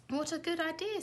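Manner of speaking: high, eager exclamation; Zog's happy cry; eager delight. A narrator's reading. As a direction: jump at the idea. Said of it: "said Zog".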